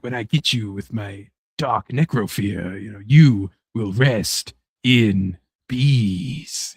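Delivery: a hint of a menacing British villain; evil British voice. Mock evil British villain taunting his wrestling rival. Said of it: "you have your evil British voice like this".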